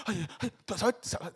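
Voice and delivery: ordinary talk, nothing marked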